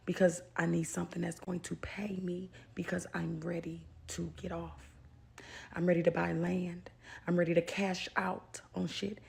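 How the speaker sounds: hushed, breathy whisper. Real, not acted. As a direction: say it under your breath. Whispered goals.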